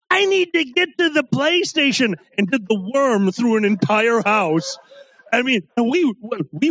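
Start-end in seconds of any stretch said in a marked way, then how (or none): 0.1-2.1 put-on drunk friend voice.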